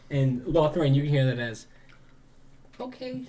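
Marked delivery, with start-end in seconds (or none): 2.8-3.2 tiny high, soft goblin voice; very timid. Acted. Goblin heard by Lothriel, timid little squeak. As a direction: squeak it out softly and shyly. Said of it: "like, very timid".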